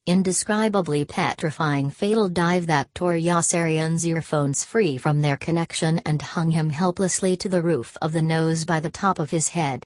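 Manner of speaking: plain reading voice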